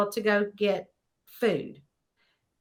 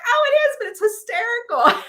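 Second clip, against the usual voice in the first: very high, louder, gushing amusement; retold enthusiasm; 13 semitones higher, 4 dB louder than usual. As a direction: act out a reader tickled by a book. Retold reader enthusing about book.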